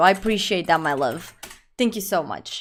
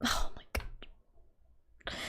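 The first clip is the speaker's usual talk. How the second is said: whispered despair; 17 dB quieter, much slower than usual. Acted. Mock mom despair.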